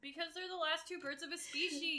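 loud, high-pitched insistence; emphatic exclamation. Real, not acted. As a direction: burst out with the obvious answer. Emphatic answer to co-host's question.

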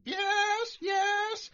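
very high, squawky bird voice; traumatized parrot. Acted. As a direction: squawk like a shaken, humbled parrot. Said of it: "just completely traumatized".

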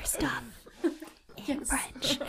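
whispered echo through giggles; hushed, playful repeat; laughing whisper. Pretend. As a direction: whisper it, barely holding in laughter. Echoes the joke whisper while laughing.

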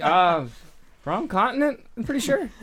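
mock-puzzled high voice; retold doubtful aside. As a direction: raise your voice high, hesitant quote. Retelling own puzzled reaction.

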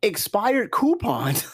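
drawn-out disbelief on expired coupons; slow incredulous reveal. Real, not acted. Host reading out a bad gift, slow and high. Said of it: "I gotta keep my composure".

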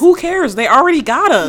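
high, loud echo of agreement; exasperated shout. Real, not acted. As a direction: yell it in heated agreement. Echoing co-host's exasperated outburst.

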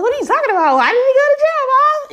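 high shocked shriek at the news. Retold or imitated. Teen girl to her mom about [name], high shocked shriek. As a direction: shriek it out in disbelief. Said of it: "I was like".

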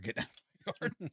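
mock-holler at kids on the lawn; cranky old man yell. Yelling at trespassers off his yard, mock high yell. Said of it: "I will yell at a motherfucker".

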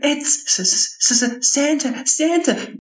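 put-on high, stammering little boy's voice; awestruck kid. Young boy stunned to find Santa, high and stammering. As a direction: gasp and stumble over the name.

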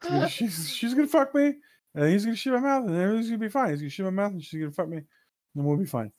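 mock panic. Panicked mock rant after the threat.

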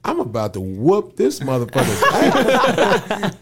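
high, slow, laughing mock threat; comic outrage. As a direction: vent the threat in hammed-up exasperation. Fuming aside about the director.

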